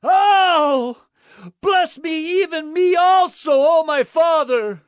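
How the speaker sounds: very high, anguished wail; great bitter cry. A narrator's reading. Son begs his father for a blessing, wailing bitterly. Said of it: "he cried out with an exceedingly great and bitter cry".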